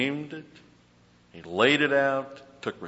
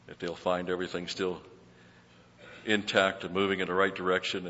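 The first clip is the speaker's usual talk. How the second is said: steady, reverent pastor's prayer; earnest prayer; 3 semitones lower, 3 dB quieter than usual. Acted. Pastor praying for the church before the study, reverent prayer voice. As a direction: pray it over the congregation.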